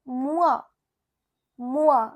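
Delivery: mois as French "mwah"; French pronunciation. Shown as a demonstration. Teacher giving the French for months, careful French mois. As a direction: say mois carefully, falling, then repeat. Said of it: "months in French are known as".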